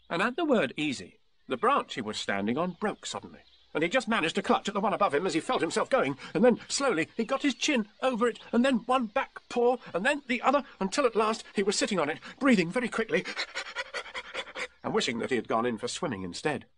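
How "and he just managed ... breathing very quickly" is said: high, strained narrator mid-scramble; suspenseful, high-pitched telling; tense story narration. A narrator's reading. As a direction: narrate the near fall in a raised, effortful voice. Narrates Tigger's desperate scramble upward.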